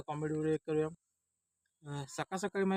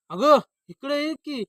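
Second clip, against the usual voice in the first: high, loud, drawn-out yell; shouted call; 7 semitones higher, 9 dB louder, slower than usual. Acted. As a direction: holler for the wife to come. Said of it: "एवढं का ओरडताय मला?". (मोठ्याने ओरडून हाक)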